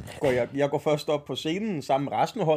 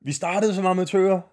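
raised, higher-pitched mock yell into a microphone; imitating a shouted thank-you speech; retold mic shout; 5 semitones higher, 4 dB louder than usual. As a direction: re-enact a drunken shouted acceptance speech, half full force. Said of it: "altså, råbt ind i".

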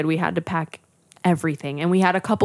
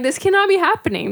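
high stunned disbelief; 12 semitones higher than usual. Retold or imitated. New mother in pain to herself, high disbelieving cry. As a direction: pitch jumps up in shock.

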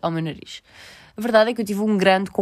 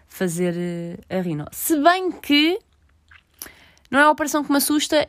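normal talking voice

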